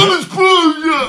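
high, slow cartoon yell; Patrick. Podcaster retrying a Patrick imitation, high slow cartoon yell. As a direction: stretch the name out in a high goofy cry. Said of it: "uma imitação do Patrick".

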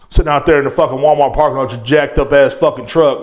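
ordinary talk

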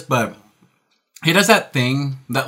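ordinary talk

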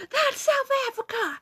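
high, exaggerated teacher voice; mock teacher yell. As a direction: squeal it like the outraged teacher. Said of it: "Mister Allen yelled".

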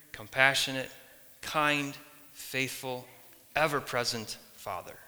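ordinary talk

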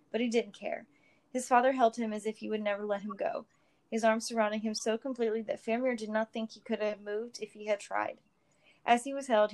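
ordinary delivery, nothing marked

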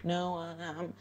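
teasing imitation of a sister who brags about podcasts; mocking the sister. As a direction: rattle it off like a know-it-all sister.

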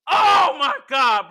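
loud, high, drawn-out exclamation; real elation. Real, not acted. Fan raving about the best segment, elated shout. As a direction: cry out, blown away by it.

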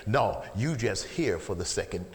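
ordinary talk, nothing marked

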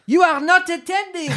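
high squeaky stern mouse; a small mouse snapping at a daydreamer; severe mouse. Acted. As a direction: scold her like a prim little mouse. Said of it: "said the mouse to Alice severely".

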